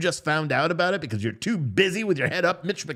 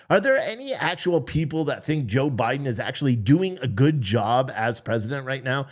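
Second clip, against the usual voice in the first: ordinary talk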